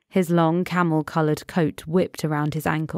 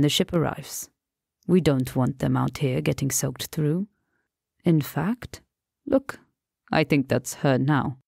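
calm Persian friend. Acted. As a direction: soothe him, lightly amused. Rassam calms George.

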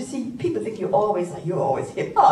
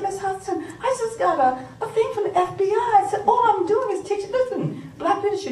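retold alarm; 11 semitones higher than usual. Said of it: "I called Miss Hudson".

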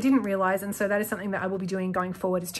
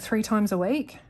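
usual speaking voice

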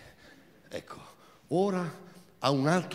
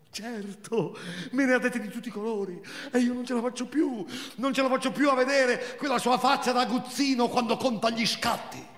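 higher, fast, furious complaint; Marina's thin voice; 8 semitones higher than usual. Acted. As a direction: spit out the grievance high and thin. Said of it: "quella sua voce sottile e inadeguata". (voce sottile, rabbiosa)

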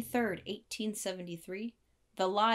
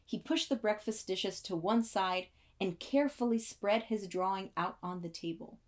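usual speaking voice

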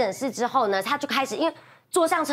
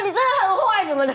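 higher-pitched mock whiny accusation; tearful scolding; 7 semitones higher than usual. Crying patient telling off the doctor, mock whiny scolding. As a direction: imitate a sobbing patient telling someone off. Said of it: "这样还骂医生".

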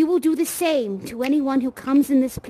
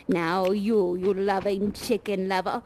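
usual speaking voice

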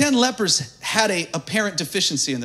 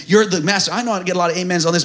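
ordinary talk

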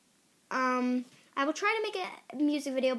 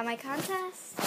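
usual speaking voice

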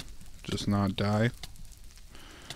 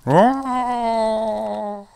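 mock crying; 11 semitones higher, 3 dB louder, much slower than usual. Game frustration.

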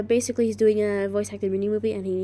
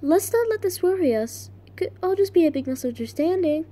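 higher-pitched audition read; higher character voice, dismissing a worry; Kara; 5 semitones higher than usual. Acted. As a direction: act it out, pitched well up. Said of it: "Let's do this again".

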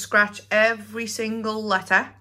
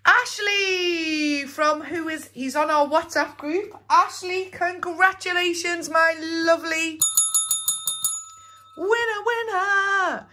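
excited; 8 semitones higher than usual. Real, not acted. Celebrating the week's winner.

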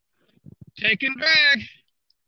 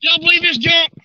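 higher, raised excited voice; thrilled, calling out the surprise catch; 5 semitones higher than usual. Real, not acted. Fish bites while he is packing up.

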